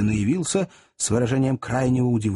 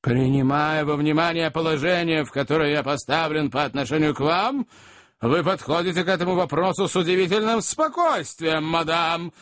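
indignant Magnus; 4 semitones higher than usual. A narrator's reading. Magnus voiced in narration.

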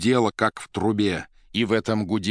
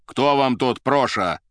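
slow, raised, haughty question; 5 semitones higher, 5 dB louder, slower than usual. A narrator's reading. Suit rebukes secretary for familiarity, haughtily. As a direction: ask it with lofty indignation. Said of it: "осведомился надменно костюм".

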